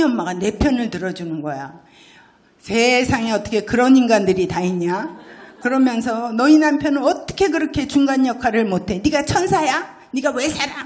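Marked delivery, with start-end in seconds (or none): 2.6-10.8 higher and louder, outraged on her behalf; indignant friend. Retold or imitated. Hwajeong's mom scolding the husband for her friend, indignant. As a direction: rant like a friend taking your side.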